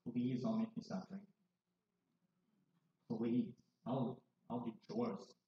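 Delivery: quiet begging. Retold or imitated. Son begging his mom at the bedside, softly pleading. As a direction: beg her softly to let you drink.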